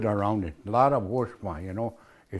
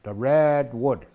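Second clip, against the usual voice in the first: slow teaching; slower than usual. Shown as a demonstration. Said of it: "Really red".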